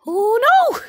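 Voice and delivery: high, loud cry of alarm; panicked yelp; alarmed shout. Real, not acted. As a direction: shriek as the enemy appears. Gamer cries out at danger.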